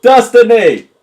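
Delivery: high, loud holler; big over-clear yell; yelled repeat. Pretend. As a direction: shout the word so it finally gets through. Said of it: "You didn't understand that".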